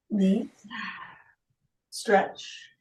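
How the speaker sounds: soft whispered cue; whispering. Real, not acted. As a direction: breathe the words out. Yoga cue during stretch.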